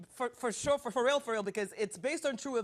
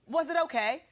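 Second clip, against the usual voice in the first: put-on British accent, higher and louder; 5 semitones higher, 5 dB louder than usual. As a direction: answer brightly in imitation British, pitched up. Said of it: "That was pretty good when you were doing it".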